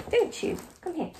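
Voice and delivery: very high cooing pet voice. Real, not acted. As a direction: coo to the puppy. Cooing at her dog.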